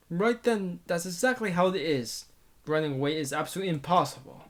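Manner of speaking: ordinary delivery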